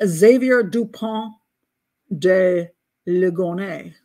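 slow, careful French accent on "Xavier Dupont de Ligonnès". Shown as a demonstration. Host tries the suspect's French name in a French accent. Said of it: "I'm going to make it sound like a French accent".

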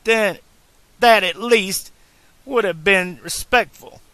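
loud, high, furious rebuke; angry scolding. A narrator's reading. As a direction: tear into him, voice climbing. Clara berates Doc.

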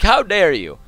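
mock outrage. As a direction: scold the robot in fake outrage.

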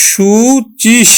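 সুচিস drawn out slowly as the first part; সুচিস stretched, then the m follows; syllable by syllable. Shown as a demonstration. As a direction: break off সুচিস slowly before the m. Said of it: "সংস্কৃতের নিয়ম অনুসারে উচ্চারণ করব".

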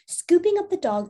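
ordinary delivery

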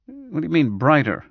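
snapped. A narrator's reading. Doctor questions patient's odd remark, mildly snapping. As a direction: snap the question back, lightly. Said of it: "Brad snapped".

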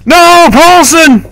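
high anguished yells of rage; raging gamer. Real, not acted. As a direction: scream in frustration as ally dies. Teammate dies, player rages.

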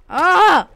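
loud high shriek of alarm; panicked scream. Real, not acted. Player screaming as the house blows up. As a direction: let out a sudden scream. Creeper explodes.

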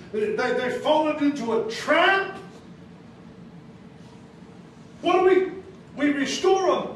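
climbing sermon questions; preaching build-up. Acted. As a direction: press the congregation like a revival preacher. Preaching at full force.

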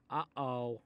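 ordinary delivery

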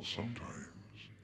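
plain reading voice

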